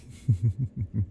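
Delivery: a falling breathy laugh; creepy little laugh; gloating laugh. Acted. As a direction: laugh over your prize. Stalker gloating over captive.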